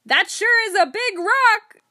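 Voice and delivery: chest-out bellow, very high and loud; hammed-up shout. Joker shouts back at the foreman who corrected him, mock-bold shout. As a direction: shout it slowly and far bigger, puffed up for comedy. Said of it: "stuck out my chest and shouted".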